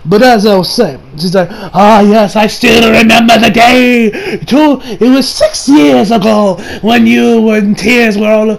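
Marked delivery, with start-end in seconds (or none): 1.7-8.5 higher, slow theatrical relish; hammed-up gleeful villain; gloating villain reminiscence. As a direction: savor the memory like a creepy cartoon villain. Villain gleefully reminisces in acted quote.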